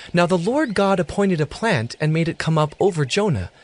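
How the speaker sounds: ordinary talk, nothing marked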